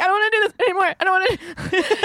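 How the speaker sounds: put-on very high, childlike protest; high-voiced retold meltdown, played for laughs; mock distressed whimper. Retelling being shaken after moose chase.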